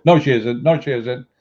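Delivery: imitating an upset client insisting loudly; defensive denial. Client denies in retold argument.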